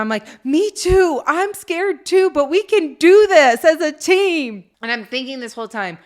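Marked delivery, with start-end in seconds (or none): 0.4-4.6 high, loud, slow scared rallying; scared mom. Retold or imitated. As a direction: squeal it out, scared but brave. Mom admits fear, rallies kids.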